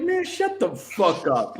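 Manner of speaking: fed up. Retold or imitated. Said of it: "I'm like".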